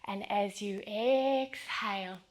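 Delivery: ordinary talk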